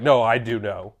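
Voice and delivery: low, soft self-assurance; smug know-it-all. Acted. Smart guy answering the doubters, smug certainty. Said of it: "And then he's like".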